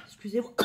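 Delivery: real sneeze breaking into speech; sneezing. Real, not acted. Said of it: "Je vais éternuer". (éternuement soudain)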